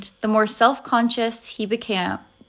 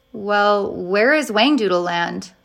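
plain reading voice

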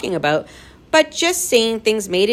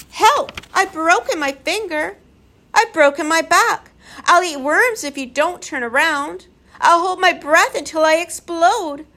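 high, wailing creature pleas; a touch of hammed-up creature wailing; howling sobs; 11 semitones higher, 4 dB louder than usual. A narrator's reading. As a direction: whine and howl like spoilt creatures left behind. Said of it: "they sobbed and howled".

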